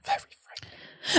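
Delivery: soft whispered echo; hushed echo. Acted. Co-host echoing the article's phrase, whispered. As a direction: whisper it under the reader.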